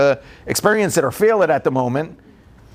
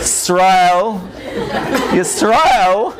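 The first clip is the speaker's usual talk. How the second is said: calling out name; much slower than usual. Retold or imitated. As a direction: call the kid by name, stern but amused. Father calling son sternly.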